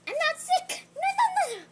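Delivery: high, loud squeaky animal voice with a laugh; sick rhino protesting. Acted. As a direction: play the rhino denying he's sick, laughing.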